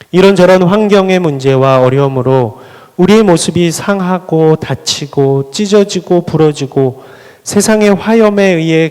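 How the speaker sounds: loud, high rising sermon climax; preaching at full force. Acted. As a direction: build the preacher's cry to its peak.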